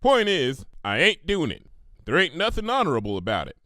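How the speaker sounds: a hint of a firm country mare; stubborn farm pony. Applejack flatly refusing the money, firm. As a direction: put your hoof down.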